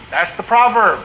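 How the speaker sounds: ordinary delivery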